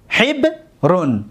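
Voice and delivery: حبرون split slowly into hib, then run; syllable by syllable. Shown as a demonstration. Teacher showing students the fifth pattern, حبرون slowly in separate syllables. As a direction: break حبرون in two, drawing out each part.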